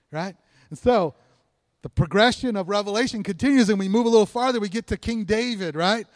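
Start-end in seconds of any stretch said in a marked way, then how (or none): none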